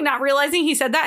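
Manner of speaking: ordinary delivery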